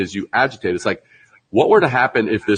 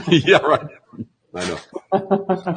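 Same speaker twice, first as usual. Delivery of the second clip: laughing; 8 semitones higher, much slower than usual. Real, not acted. Laughs at the joke.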